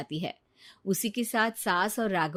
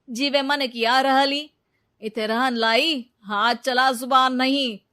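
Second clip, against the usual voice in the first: louder, sneering bully; contemptuous threat; 4 semitones higher, 7 dB louder than usual. Acted. Ravi threatening Neeta to throw her out, contemptuous. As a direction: put her in her place, harshly.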